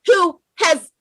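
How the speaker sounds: real crying shout that cracks mid-phrase; words choke off as the tears take over; voice breaking into tears. Real, not acted. Rallying listeners for Mazi Nnamdi Kanu, breaking down in real tears. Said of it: "let me calm down".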